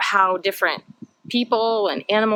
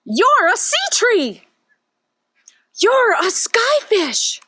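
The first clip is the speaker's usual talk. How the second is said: surprised exclamation; 5 dB louder, slower than usual. Acted. Characters exclaim at each other.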